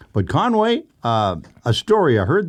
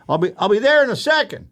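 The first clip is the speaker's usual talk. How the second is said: calling from upstairs; 12 semitones higher, faster than usual. Retold or imitated. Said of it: "She yells down".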